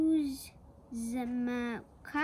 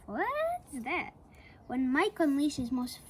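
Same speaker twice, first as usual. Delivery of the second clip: ordinary delivery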